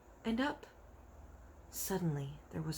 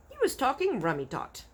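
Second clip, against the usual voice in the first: friendly giant voice; 7 semitones higher, 3 dB louder than usual. Acted. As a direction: savour every word like a friendly giant showing off. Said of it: "the B F G said".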